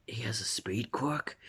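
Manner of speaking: breathless whispered disbelief. Retold or imitated. Ida sees Deku's speed, whispered surprise. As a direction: hush it to yourself in shock. Ida's startled private thought.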